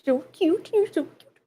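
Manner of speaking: high, soft coo. Real, not acted. Owner cooing at her cute dog.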